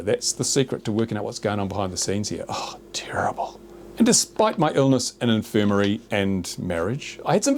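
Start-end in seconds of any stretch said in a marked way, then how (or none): 0.0-3.6 conspiratorial whisper. Pretend. Vlogger sharing a secret theory with viewers, in a conspiratorial whisper.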